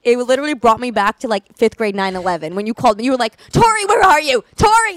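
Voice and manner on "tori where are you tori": high, loud panicked shout; mock hysterical calling. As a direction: imitate a mother frantically searching. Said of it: "I was hysterical".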